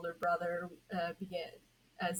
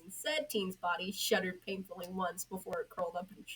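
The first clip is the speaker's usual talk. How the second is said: ordinary delivery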